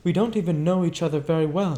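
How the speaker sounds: Daisy confiding in her cousin Nick; Daisy speaking. Acted. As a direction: speak suddenly and searchingly.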